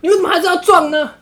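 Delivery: put-on high, loud girlfriend complaint broken by laughter; laughing mock scolding. Girlfriend demanding he confess to the friend, told through laughter. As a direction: scold him while cracking up.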